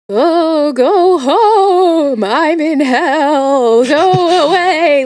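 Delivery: high, drawn-out ghost moan; ghostly wail. Retold or imitated. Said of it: "dead Vietnamese soldiers being like".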